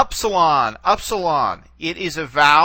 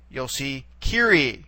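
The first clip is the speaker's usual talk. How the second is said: usual speaking voice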